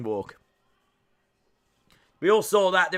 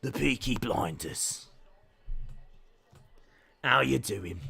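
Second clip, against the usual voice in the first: put-on Birmingham accent, pitched lower; 6 semitones lower, 5 dB quieter than usual. Streamer playing up family's Birmingham roots, Birmingham accent. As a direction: do a low, softer Peaky Blinders Birmingham accent. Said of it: "trying to put the accent on".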